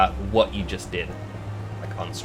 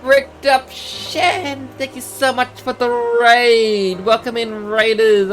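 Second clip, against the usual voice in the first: excited welcome; 12 semitones higher, 4 dB louder, slower than usual. Real, not acted. Welcoming raiders to the stream.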